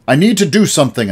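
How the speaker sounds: loud, high, quick outburst; shout. A narrator's reading. The boy shouting at his uncle, frustrated outburst. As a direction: let it burst out of him. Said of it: "I shouted".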